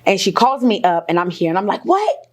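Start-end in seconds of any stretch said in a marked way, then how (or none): 1.8-2.3 high-pitched disbelieving yelp; shocked "what". Retold or imitated. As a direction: recreate a stunned, high "what?". Acting her shocked reaction on phone.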